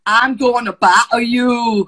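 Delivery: loud, slow mock-Scottish threat; amateur Scottish impression, drawn out loud; attempted Scottish accent. Shown as a demonstration. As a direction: belt out a hammed-up Scottish accent. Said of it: "Don't wanna do a Scottish accent".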